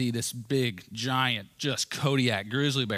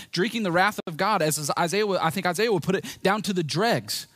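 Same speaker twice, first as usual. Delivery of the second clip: full-force preaching; 6 semitones higher than usual. Acted. Pastor pressing the congregation about what they deserved, preaching hard. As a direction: preach it out with urgency.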